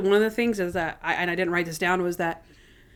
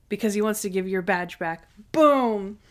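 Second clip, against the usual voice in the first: usual speaking voice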